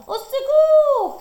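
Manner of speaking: very high, loud, slow panicked cry; cry for help. A narrator's reading. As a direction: scream while running from the bear. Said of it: "crie Hiawatha en s'enfuyant". (Hiawatha fuit l'ours, crie au secours)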